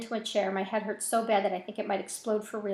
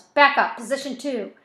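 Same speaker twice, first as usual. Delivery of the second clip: loud, raised tactical command; yelled order; 4 semitones higher, 9 dB louder than usual. A narrator's reading. Rifleman calling for backup, yelling. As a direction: call it out to the team. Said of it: "He yells".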